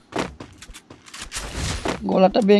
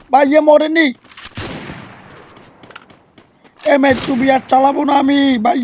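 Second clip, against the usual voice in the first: higher, louder angry outburst; frustrated yell; 6 semitones higher, 4 dB louder than usual. Real, not acted. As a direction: shout at the unfair game. Frustrated gamer yells about enemy.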